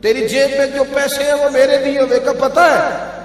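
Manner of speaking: high, loud scolding reminders; threatening uncle. Acted. Uncle loudly reminding nephew he owes him everything. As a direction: lay out each debt like an angry benefactor.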